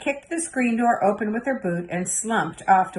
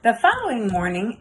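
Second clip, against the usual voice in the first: ordinary delivery, nothing marked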